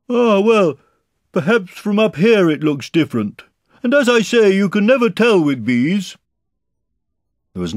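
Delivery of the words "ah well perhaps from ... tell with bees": high, loud bear voice; Pooh calling down. A narrator's reading. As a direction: philosophise loudly from up in the air.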